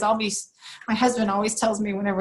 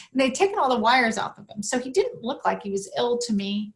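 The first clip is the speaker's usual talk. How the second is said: ordinary talk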